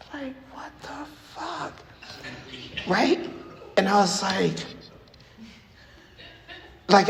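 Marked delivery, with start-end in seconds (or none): 0.1-1.7 breathy whispered disbelief; hushed, high what-the-fuck; shocked whisper at the door; stunned whisper. Retold or imitated. Stunned reaction to the pickup.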